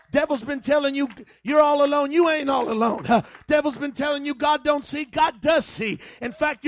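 loud, driving preacher's cry to the congregation; preaching at full force. Acted. Preacher shouting hope to the congregation, full-force preaching. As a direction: shout the comfort over the church like a revival preacher.

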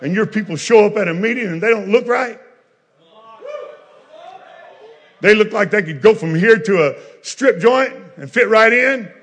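loud, high, shouted revival preaching; full-force preaching. Acted. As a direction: preach it hard to the congregation.

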